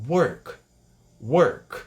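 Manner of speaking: "work" with an "uh" vowel and bare final K; native-style "work", ending on a mute K; correct English "work". Shown as a demonstration. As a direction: slowly model "work", no vowel after the K. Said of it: "Mas a pronúncia é".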